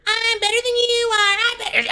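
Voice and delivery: very high squeaky bird voice; whiny falsetto bird bragging; chirping bird. Acted. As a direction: chirp it like a smug little bird. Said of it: "little birds out there just chirping".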